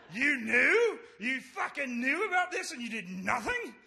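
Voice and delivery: high, loud outraged accusation; yelling. Retold or imitated. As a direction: yell it in disbelief, imitating an angry coworker. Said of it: "pointing at me and yelling".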